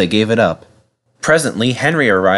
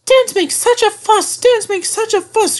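very high squeaky chant; grumbling coaches. A narrator's reading. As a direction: squeal the complaint in a repeated train rhythm. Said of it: "grumbled his coaches".

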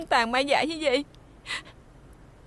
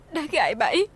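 ordinary talk

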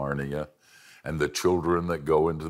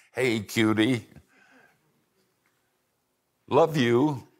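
put-on higher, cooing baby talk; 6 semitones higher, 3 dB louder, slower than usual. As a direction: coo it like talking to a baby. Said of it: "God's baby talk".

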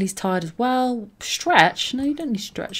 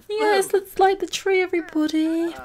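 cheery announcer; 10 semitones higher than usual. Pretend. Cheering Sims to light tree.

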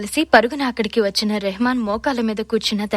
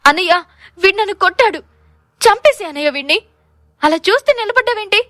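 high, loud cry to her brother after being slapped; slapped sister screaming, higher and louder; tearful shout; 9 semitones higher, 6 dB louder than usual. A narrator's reading. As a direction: shriek for her brother to kill him. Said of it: "అని రెహమాన్ వంక చూస్తూ అరిచింది".

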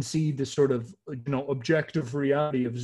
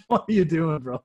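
louder, incredulous mock complaint; mock protest; 3 semitones higher, 5 dB louder than usual. Humanity questioning Zeus, mock protest. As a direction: protest it to a buddy, half laughing.

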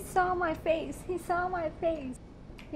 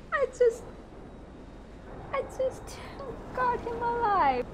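higher, pleading alien calling to a human; 7 semitones higher than usual. Acted. Alien pleading with the human captive, higher creature voice.

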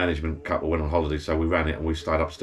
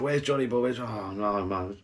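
lightly raised, drawn-out pub mate calling upstairs; mate Richard calling up; 5 semitones higher, slower than usual. Retold or imitated. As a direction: coax a mate down from the bar, a bit higher. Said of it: "his voice sort of sticks out a little bit".

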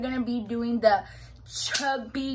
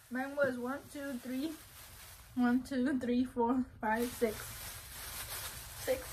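ordinary talk, nothing marked

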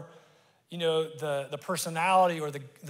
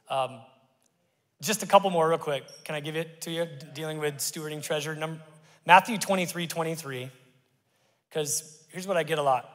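normal talking voice

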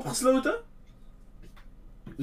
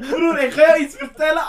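loud, higher, laughing exclamation; shouted through real laughter; 4 semitones higher, 6 dB louder than usual. Real, not acted. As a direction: call out while still cracking up.

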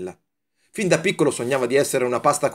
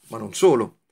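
usual speaking voice

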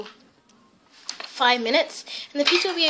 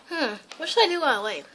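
usual speaking voice

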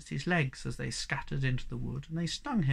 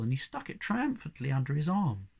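plain reading voice

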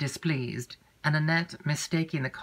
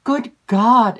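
louder, sudden cry of shock; shocked exclamation; 8 semitones higher, 12 dB louder than usual. A narrator's reading. Emily shocked by what her aunt said, loud exclamation. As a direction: gasp it out, scandalised. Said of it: "exclaimed Emily".

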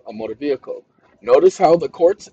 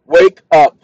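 loud wake call; 7 dB louder than usual. Shown as a demonstration. Man waking the assistant again with a loud call. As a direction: wake up called out loud at normal pitch. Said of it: "Watch this".

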